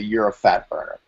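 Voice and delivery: usual speaking voice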